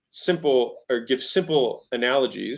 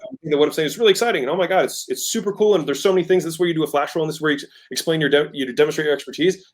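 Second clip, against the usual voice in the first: higher, faster, gushing delivery; excited salesperson rush; really excited; 4 semitones higher, 3 dB louder, faster than usual. Shown as a demonstration. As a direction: speed up and lift the pitch with excitement. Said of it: "If I'm splitting back up".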